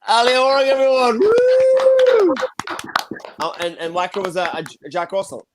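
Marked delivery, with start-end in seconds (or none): 0.1-1.2 loud, high hyped-up host intro; big cheering introduction for the crowd; excited announcement. Real, not acted. Host introduces comedian to audience. 1.2-2.3 loud high whoop of cheering; cheering woo. Real, not acted. Host cheers after introduction.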